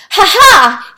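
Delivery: high loud triumphant laugh. Acted. Rooster greeting his animals, loud gleeful laugh. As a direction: laugh out in glee. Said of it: "he laughed".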